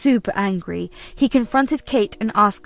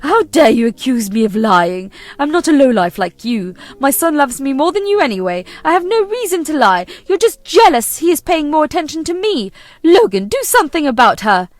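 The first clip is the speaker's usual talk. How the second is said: higher and louder, accusing the son; angry mother-in-law; 4 semitones higher, 7 dB louder than usual. Acted. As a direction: spit out every insult at the son's wife. Kate lashes out at wife.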